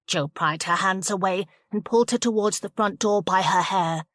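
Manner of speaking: usual speaking voice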